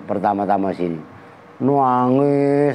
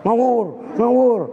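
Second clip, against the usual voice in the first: higher grumbling widower cat; 8 semitones higher than usual. Acted. Preacher voicing the widower cat scolding. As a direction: brush it off like an old tomcat. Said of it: "kucing duda jawab".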